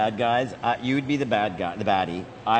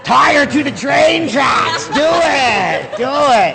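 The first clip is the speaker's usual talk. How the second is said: hammy melodrama villain urging, higher and louder; mustache twirling villain; 10 semitones higher, 7 dB louder, much slower than usual. Pretend. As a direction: egg on the villain, high and loud. Egging on villain to evil deed.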